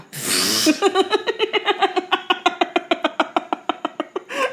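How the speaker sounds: long high giggle at herself; high laugh. Real, not acted. Laughing at her own silly Aussie threat. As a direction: crack up at her own joke. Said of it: "you like the way you go".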